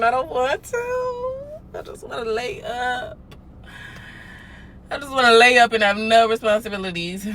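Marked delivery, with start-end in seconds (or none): 0.0-3.2 mock whine. Whiny fake crying.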